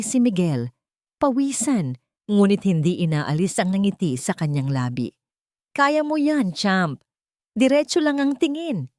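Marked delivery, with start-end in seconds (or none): 5.7-8.9 shouted encouragement. A narrator's reading. Father cheering son learning to ride a bike, shouting. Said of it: "sigaw ni Miguel".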